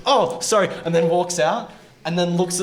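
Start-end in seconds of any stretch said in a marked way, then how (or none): 0.1-0.7 high, startled quick apology; flustered retold apology. Man walks into the bathroom, mock startled apology. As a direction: blurt it high like you walked in wrong. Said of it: "looks at me and goes".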